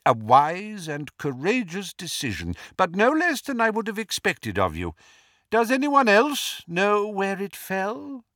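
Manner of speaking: put-on approving old headmaster, pitched high; Dumbledore. A narrator's reading. Portrait headmaster praising Harry's decision, high approving voice. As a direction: praise him in a lifted headmaster's voice, then ask.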